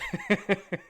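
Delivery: short laugh breaking out; amused laugh. Real, not acted. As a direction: laugh at own joke. Laugh after accent joke.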